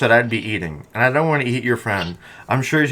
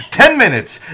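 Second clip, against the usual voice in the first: mock shout; 10 semitones higher, 7 dB louder than usual. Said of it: "He's like".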